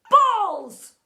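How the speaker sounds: slow, loud booming complaint; exasperated bellow. A narrator's reading. Baffled teacher complains to pupil he cannot spot balls, exasperated bellow. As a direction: belt it out, punching the last word.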